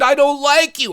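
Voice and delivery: put-on high, emphatic little-girl voice; imitated schoolgirl tantrum; childish outburst. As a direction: fling it out like an upset child. Girl quarrels with boy.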